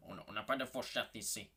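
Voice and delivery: a light put-on voice for the waiter's line; mock waiter voice, lightly done; imitating the Chinese waiter. Shown as a demonstration. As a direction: show how the waiter said it. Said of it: "Mais d'une façon de même".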